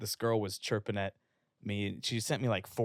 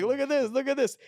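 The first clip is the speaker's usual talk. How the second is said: excited callout; 6 dB louder than usual. Retold or imitated. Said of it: "And I was like".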